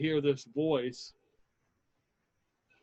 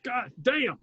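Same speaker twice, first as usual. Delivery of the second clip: a much higher frightened yelp; panicked guard; 9 semitones higher than usual. Acted. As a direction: yelp it as you bolt. GM voices fleeing guard.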